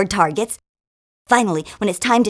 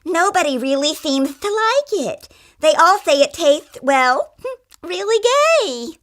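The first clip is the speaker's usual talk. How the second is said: much higher, campy winemaker voice; 10 semitones higher than usual. Acted. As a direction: put on a camp voice, confiding.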